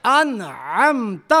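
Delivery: drawn-out انعمت with a throat ain; slow recitation demonstration. Shown as a demonstration. Teacher modelling for students, slow انعمت. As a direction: chant انعمت, leaning into the middle.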